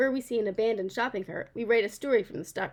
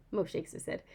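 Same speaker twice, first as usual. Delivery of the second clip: usual speaking voice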